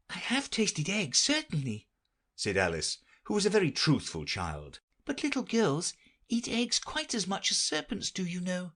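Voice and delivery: put-on high, polite young girl; Alice's voice. Alice apologising to the pigeon, polite high girl voice. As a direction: apologise gently.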